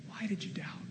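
whispered softly, with no voice; a tender whisper from Jesus to Peter; gentle whisper. Acted. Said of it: "just like he says to Peter".

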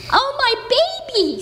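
high squawking mother hen. Acted. Mother hen greeting her lost chick, high squawk. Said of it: "squawked the mother hen".

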